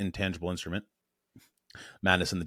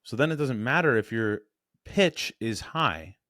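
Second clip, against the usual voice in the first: ordinary talk, nothing marked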